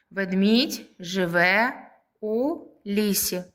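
slow, separated words for pupils; teacher spacing out each word. Shown as a demonstration. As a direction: dictate it slowly, a pause between words. Rereading sentence word by word.

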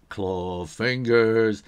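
slow growly claw voice. Shown as a demonstration. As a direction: drag the words out like a clawed monster. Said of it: "sort of claw fingers".

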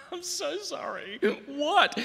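high, bewildered apology; apologetic confused foreigner. Pretend. Comedian voicing himself as the baffled Australian. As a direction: sound utterly lost and sorry. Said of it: "I could either go".

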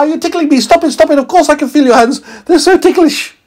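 very high laughing squeak; giggling tickled meteorite. A narrator's reading. Meteorite tickled by scientist, high giggling. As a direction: giggle and beg to stop. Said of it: "said the meteorite".

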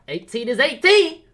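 loud high exclamation; shout. Real, not acted. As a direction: yell it defensively. Yelled defense.

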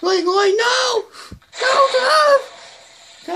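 very high, panicked cries; scared shouting. Real, not acted. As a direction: shout in alarm to stop someone. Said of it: "That's kinda scared me a little".

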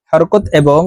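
ordinary talk

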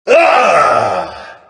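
sharp high yelp of pain; pained cry. Acted. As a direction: cry out as if hit by a bullet. Game character hit cry.

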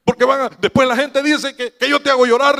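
high, loud protest to mother; retold flustered plea. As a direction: plead urgently, rising in pitch, half in jest. Retells pleading with crying mother.

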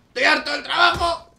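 high, loud worker rant; fed-up yell. Pretend. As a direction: scream out your fed-up rage. Rampaging office worker in game.

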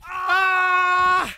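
usual speaking voice

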